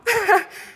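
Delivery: short falling laugh; quick chuckle; amused laugh. Real, not acted. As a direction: laugh at the joke. Laughs at mom's text.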